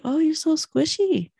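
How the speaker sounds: high-pitched little nephew voice. Retold or imitated. Woman retelling her small nephew's teasing, lightly voiced high. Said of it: "My little nephew".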